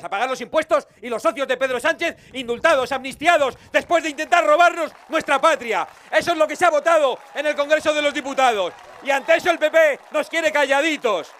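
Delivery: crescendo hasta una voz alta y aguda; arenga indignada. Real, not acted. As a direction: sube el volumen encadenando agravios, como en un mitin. Arenga indignada que crece en mitin. (arenga política indignada, a pleno pulmón)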